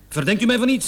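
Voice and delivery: sudden jolt of fear at the accusation; alarmed. Acted. Suspect alarmed under police questioning. (geschrokken, hoger en luider)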